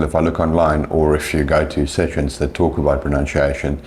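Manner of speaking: normal talking voice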